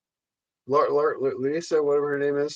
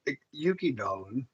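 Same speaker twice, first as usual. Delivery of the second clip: lightly camp New York coo, dropping low; 5 semitones lower, 12 dB quieter than usual. Retold or imitated. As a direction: coo the name like a campy New Yorker, much softer. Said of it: "I did it in my gayest New York voice I could".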